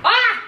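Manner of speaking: high sudden yelp of alarm; real startled cry. Real, not acted. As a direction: cry out in surprise.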